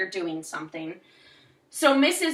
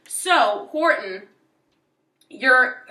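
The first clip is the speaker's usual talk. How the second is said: normal talking voice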